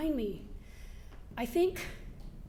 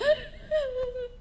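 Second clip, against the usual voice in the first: gasp; 11 semitones higher than usual. Retold or imitated. Retold gasping while drilling.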